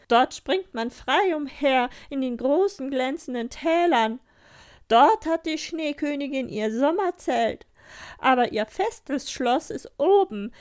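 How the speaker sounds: hohe, laute, langsame Tierstimme; schwärme laut und langsam vom Schnee; schwärmendes Rentier. Acted. (Rentier schwärmt Gerda laut vom Norden vor)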